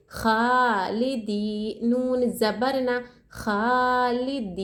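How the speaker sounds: very slow, खा and दी each held one alif length; teacher chanting the letters of खालिदी; stretched tajweed spelling. Shown as a demonstration. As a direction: draw out खालिदी syllable by syllable. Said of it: "या मद्दा को एक अलिफ की मकदार खींचेंगे".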